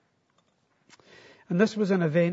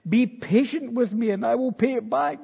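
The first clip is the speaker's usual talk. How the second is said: raised, louder pleading voice; begging; 7 semitones higher, 5 dB louder than usual. A narrator's reading. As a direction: plead urgently, pitched high. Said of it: "fell to his knees and begged him".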